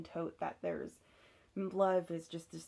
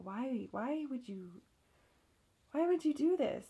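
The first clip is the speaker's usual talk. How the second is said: pleading why; 7 semitones higher, 4 dB louder than usual. Retold or imitated. Pleading with partner after betrayal.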